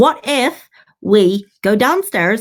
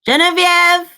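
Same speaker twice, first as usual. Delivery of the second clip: higher, drawn-out call; yelling; 7 semitones higher, slower than usual. Acted. Adventurer calling for Genevieve inside the door, yelling. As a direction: call out a name into the dark. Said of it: "Do I hear her yelling?".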